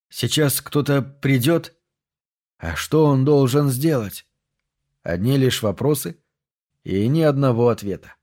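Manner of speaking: plain reading voice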